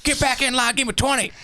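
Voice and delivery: high, barking drill sergeant voice. Retold or imitated. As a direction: scream orders at a recruit like a drill sergeant. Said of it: "This is the drill sergeant coming out".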